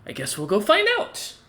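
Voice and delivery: playful threat from Rain. Acted. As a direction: lightly sing it upward as a tease. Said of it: "and she goes".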